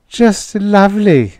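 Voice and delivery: high, sighing "just lovely". Acted. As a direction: sigh it out with delight. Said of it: "sighed the girl".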